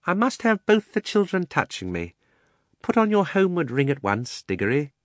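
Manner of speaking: high-pitched, mildly crafty uncle; fussy uncle voice with a scheme behind it; cunning. A narrator's reading. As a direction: coax with a touch of slyness. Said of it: "said Uncle Andrew cunningly".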